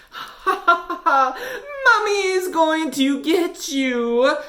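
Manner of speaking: high, slow cackling witch; high slow menacing witch laugh; witch skeleton laughing. Acted. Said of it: "laughed the witch skeleton".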